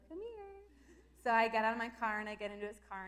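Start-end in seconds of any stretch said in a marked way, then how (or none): 0.1-0.6 lightly voiced, higher soft come here; gently voiced husband. Husband quietly calls his wife over to him. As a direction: coax her over gently. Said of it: "he kinda just goes".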